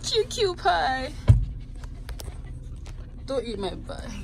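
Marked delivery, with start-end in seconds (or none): 0.0-1.1 slow, high cooing pet voice. Real, not acted. Doting on her dog Rocky, slow high baby talk.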